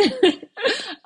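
high, emotional laugh; teary laugh. Real, not acted. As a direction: laugh through the tears.

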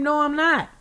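high, loud acted refusal; retold scream. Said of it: "And I scream".

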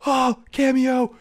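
high, slow drawn-out gasp of delight; mock awed cry. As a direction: imitate the crowd swooning over the cameo. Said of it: "everybody's like".

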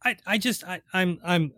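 tripping over words, stammering; stammer, lost for words; stuttering. Real, not acted. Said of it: "I'm stuttering here".

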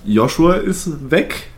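ordinary talk

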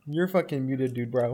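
quiet mock. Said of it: "Why'd you say it like that".

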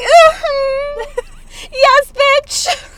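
high, loud campy mm-hmm; sassy hype. Acted. Wanting a guy to let loose, sassy hype. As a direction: shout it like a sassy hype friend, laughing. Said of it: "I want someone to be like".

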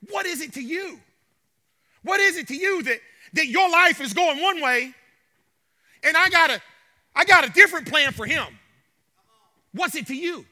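firmer, fuller voiced Jesus; stern rebuke. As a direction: say "What is it to you?" in a full preaching voice. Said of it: "Jesus gives him a stern rebuke".

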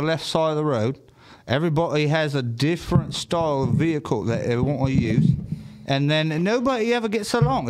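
put-on rambling party-trick British accent. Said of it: "to talk British, you know what I mean?".

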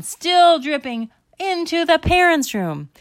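high, loud storyteller climax; frantic narration. A narrator's reading. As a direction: belt out the chase with mounting alarm. Narrator builds the frantic chase.

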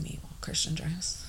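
unvoiced, hushed confession; whispered aside. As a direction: whisper it like a guilty admission. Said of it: "I said".

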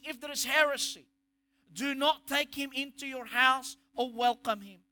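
usual speaking voice

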